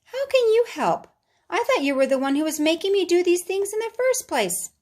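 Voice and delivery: put-on high child voice, challenging; doubtful boy. Boy challenging his own anger, high doubtful child voice. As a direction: question it like a suspicious kid.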